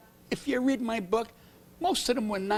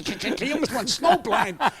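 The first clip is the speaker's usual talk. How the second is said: ordinary talk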